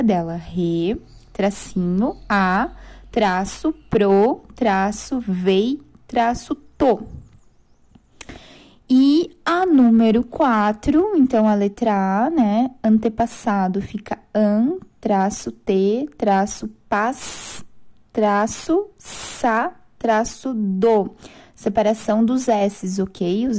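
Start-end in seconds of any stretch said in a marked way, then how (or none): 0.3-7.1 teacher spelling out syllables to students. Shown as a demonstration. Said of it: "fica assim a separação silábica". 12.8-21.1 teacher spelling out syllables to students. Shown as a demonstration.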